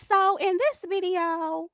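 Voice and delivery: high, drawn-out video intro; slow singsong intro, pitched well above normal; put-on vlogger opening, stretched out; regular video voice. Shown as a demonstration. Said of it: "you gonna hear me".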